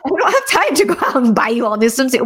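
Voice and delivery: put-upon mom, playfully retold; exasperated mom. Mom retorts to complaining kids.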